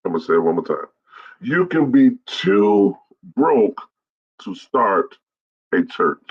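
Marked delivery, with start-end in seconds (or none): 1.4-6.2 high, slow "too broke" with pauses; emphatic repetition. Real, not acted. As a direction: break it up word by word, falling to "a church". Says key point one more time.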